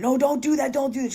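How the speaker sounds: very high and loud, imitating a frantic sister; panicked sister's plea. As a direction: cry it out high like she's begging. Sister begs him to stop.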